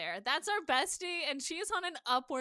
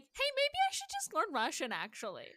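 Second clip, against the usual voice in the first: mock sudden idea; 6 semitones higher than usual. Host voicing the character's later decision to learn, mock sudden idea. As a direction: pitch it up like a casual brainwave.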